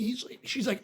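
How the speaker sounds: usual speaking voice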